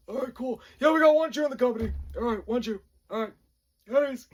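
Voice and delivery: high, loud, hurried announcement; drill sergeant voice. Retold or imitated. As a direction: call out the find to the company. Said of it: "they all talk like this".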